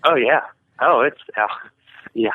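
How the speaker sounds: usual speaking voice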